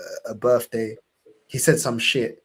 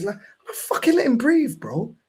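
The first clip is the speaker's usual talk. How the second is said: higher and louder, building outrage; exasperated rant; 13 semitones higher, 4 dB louder than usual. Real, not acted. Telling friends about his cousin's control, heated rant. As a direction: vent it, voice climbing.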